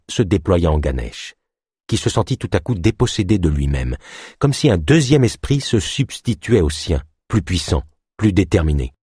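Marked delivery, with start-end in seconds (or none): none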